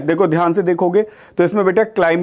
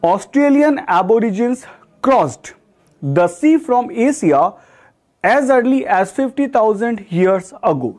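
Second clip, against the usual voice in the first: very slow, word by word sentence read; slow example reading; 3 semitones higher, much slower than usual. Shown as a demonstration. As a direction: read the example slowly for students. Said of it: "teacher reads example sentence". (उदाहरण वाक्य धीरे-धीरे पढ़ना)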